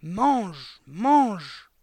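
loud repeated order, building; forceful command. A narrator's reading. Headmistress pushes the hesitating boy to eat, loud command.

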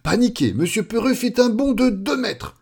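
high, alarmed storytelling; panicked narration. A narrator's reading. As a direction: narrate the jump in a fluster. Narrator shares the sudden panic. (récit affolé, dans l'aigu)

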